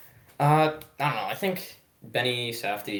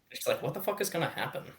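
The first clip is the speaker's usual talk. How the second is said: ordinary delivery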